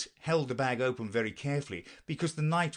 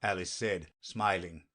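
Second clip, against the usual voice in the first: ordinary delivery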